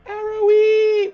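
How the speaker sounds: high, loud, slow shout of a name; calling out. Real, not acted. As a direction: hail them from across the room. Calling out a new arrival.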